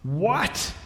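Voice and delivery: lightly acted roar of disbelief; mild mock roar. A narrator's reading. Witch hearing of Father Christmas, mock roar. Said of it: "roared the witch".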